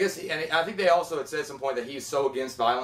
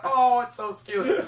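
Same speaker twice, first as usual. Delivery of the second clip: very high, drawn-out coo; mock cooing; 8 semitones higher, much slower than usual. Reacting to the dog reunion, high mock cooing. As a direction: coo slowly, far above your usual pitch.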